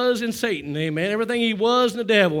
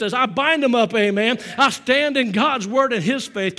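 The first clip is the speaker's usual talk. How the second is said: louder, driving preacher's cadence; full-force preaching; 4 semitones higher, 5 dB louder than usual. Acted. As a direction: preach it hard and build the fire.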